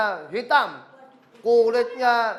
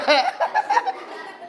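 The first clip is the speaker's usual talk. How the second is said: high giggle. Real, not acted. Teacher laughing at own joke.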